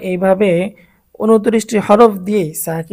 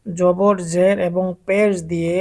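ordinary delivery

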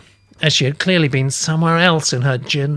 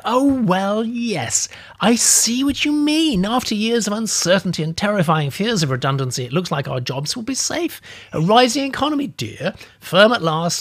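put-on animated old lady; 7 semitones higher than usual. Old lady celebrating safe jobs, animated and higher. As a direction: cheer like she's won the lottery. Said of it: "Now she was becoming animated".

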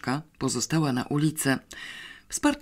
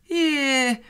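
a high, throwaway scoff; dismissive; 11 semitones higher, 3 dB louder than usual. A narrator's reading. Pawełek shrugs off his sister's worry, dismissively. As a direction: brush it off with a light sneer. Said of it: "powiedział Pawełek lekceważąco".

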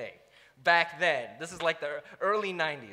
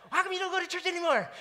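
much higher and fast, bouncing with eagerness; Energizer Bunny; 10 semitones higher, faster than usual. Shown as a demonstration. Preacher showing his Energizer Bunny eagerness to the frat brother. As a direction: rattle it off like an overexcited kid. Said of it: "really talking like this".

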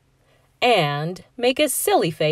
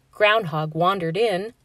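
ordinary delivery, nothing marked

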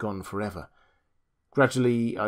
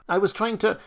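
much higher, straining to explain; agitated protest; 11 semitones higher, 4 dB louder than usual. Acted. Julius to the doctor, agitated protest. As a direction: rush to justify yourself.

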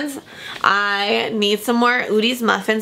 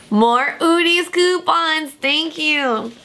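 high, drawn-out happy exclamation; pleased, pitched up and slow; delighted announcement; 5 semitones higher, much slower than usual. Real, not acted. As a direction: stretch it out with delight. Delighted at more coupons.

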